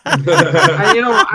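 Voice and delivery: high, slow, laughing build-up; laughing rant opener. Real, not acted. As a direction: launch the rant through laughter. Laughing rant about British stereotypes.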